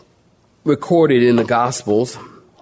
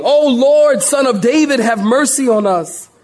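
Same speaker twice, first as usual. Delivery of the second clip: high, raised cry for mercy; acted screaming plea; 9 semitones higher, 4 dB louder than usual. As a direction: cry out desperately over a crowd. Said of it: "they start screaming".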